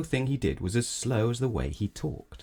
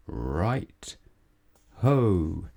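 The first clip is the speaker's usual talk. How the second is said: slow reply. Acted. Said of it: "said Mister Slow".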